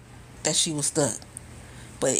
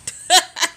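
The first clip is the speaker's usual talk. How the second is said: loud burst of laughter; 12 semitones higher, 8 dB louder than usual. Real, not acted. Laughing at own story.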